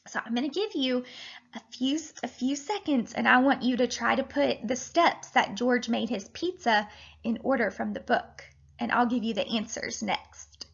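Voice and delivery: ordinary delivery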